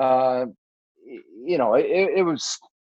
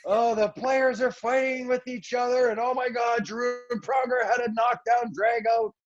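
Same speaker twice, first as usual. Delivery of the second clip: higher, hammed-up gossip tone; mock dramatic; 6 semitones higher than usual. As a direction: ham up the media's overblown drama. Mocking media's mutiny story.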